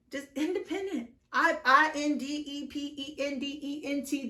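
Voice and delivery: spelled out letter by letter, mock teacherly; mock spelling lesson. Tarot reader mock-spells the card word to viewers. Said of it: "Do you know what that means?".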